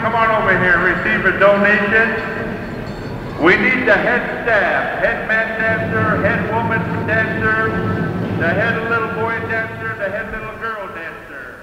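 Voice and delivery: slow, measured arena announcer; powwow emcee. Real, not acted. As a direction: call out names to the crowd.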